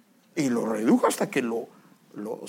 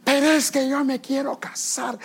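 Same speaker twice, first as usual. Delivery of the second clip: mock insistent plea; 10 semitones higher, 6 dB louder than usual. Young person insisting on marrying, mock insistent plea. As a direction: plead it insistently, pitched well up.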